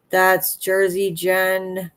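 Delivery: ordinary delivery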